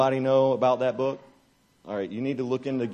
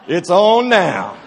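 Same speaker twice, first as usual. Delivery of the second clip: pumped up; 6 semitones higher, 9 dB louder, much slower than usual. Retold or imitated. Sees the band, resolves.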